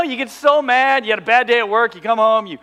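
usual speaking voice

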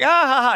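a teasing, mocking ha-ha laugh. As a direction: laugh at the old man's clumsy swing. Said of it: "I just started laughing".

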